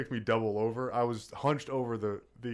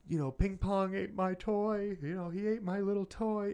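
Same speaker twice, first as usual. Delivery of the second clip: imitating a tearful little girl; upset little kid; 9 semitones higher than usual. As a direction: wail it like a small child who lost her toy. Voicing the crying daughter.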